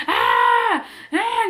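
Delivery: loud high newborn wail; imitating a baby cry. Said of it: "her cry is not like a".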